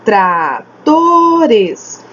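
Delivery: TRA... TOOO... RES, very drawn out; syllable by syllable. Shown as a demonstration. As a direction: stretch tratores into three slow syllables. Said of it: "É três vezes que eu falo".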